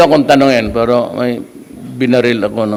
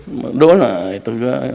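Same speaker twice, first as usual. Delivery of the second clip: ordinary talk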